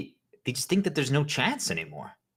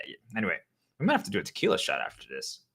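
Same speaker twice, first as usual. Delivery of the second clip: ordinary talk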